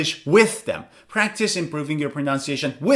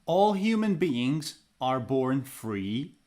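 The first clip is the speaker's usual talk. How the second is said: shadowing demo; 4 semitones lower, 8 dB quieter, slower than usual. Shown as a demonstration. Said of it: "Step two, lesson, pause, and repeat".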